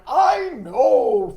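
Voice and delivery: loud frog cry; Froggy voice. Acted. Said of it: "Froggy cried".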